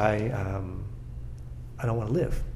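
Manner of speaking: low, soft, hesitant admission; retold despair. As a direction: quietly voice someone who has given up. Retelling a suicidal man's confession.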